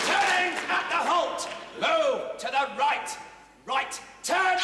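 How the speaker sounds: high-pitched parade command; drill shout. Acted. Sergeant puts the squad through turns at the halt, shouting. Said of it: "all this shouting all the time".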